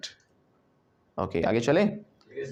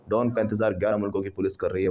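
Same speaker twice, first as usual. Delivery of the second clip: ordinary talk, nothing marked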